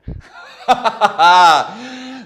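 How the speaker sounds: loud, high laughing burst; burst of laughter. Real, not acted. Laughs at own realization.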